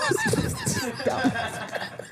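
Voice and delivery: high laugh. Real, not acted. Bursts out laughing.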